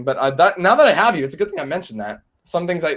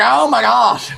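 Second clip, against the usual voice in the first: higher mock outcry; acted reaction; 6 semitones higher, 4 dB louder, slower than usual. Streamer replaying his reaction to his own bit, mock astonished cry. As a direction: exclaim it in exaggerated disbelief. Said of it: "I was like".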